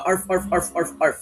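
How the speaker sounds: light yapping arf arf; a small dog's yap, lightly done; dog bark. Shown as a demonstration. Teacher trying another dog bark for students, light yapping. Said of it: "I-dissect natin yung tahol ng aso".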